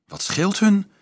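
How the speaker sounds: high, concerned question; anxious question. A narrator's reading. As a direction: ask with lifted pitch. Boy kneeling asks anxiously.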